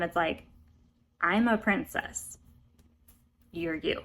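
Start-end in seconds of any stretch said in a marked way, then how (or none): 1.2-4.0 mock haughty princess, slow then pitched up; lightly put-on condescension, drawn out with a pause; Elanwi. Princess reminding the assistant pig keeper of his rank, mock snooty. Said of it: "the way that she speaks to him".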